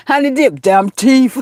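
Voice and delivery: "thief" said "teef" with a hard T; borrowed catchphrase pronunciation. Retold or imitated. Teasing Honey Dip over the crown, "thief" pronounced "teef". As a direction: throw in the friend's "teef" for "thief". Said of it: "How you say, um, Red".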